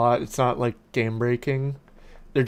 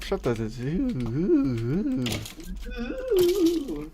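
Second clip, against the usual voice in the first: slow, then squeaky high ooh; goofy hooting ooh; 5 semitones higher, 4 dB quieter, much slower than usual. Pretend. Gamer breaks into silly hooting. Goofing mid-sentence.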